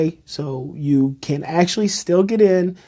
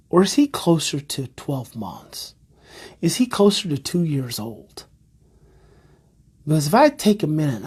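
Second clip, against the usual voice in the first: usual speaking voice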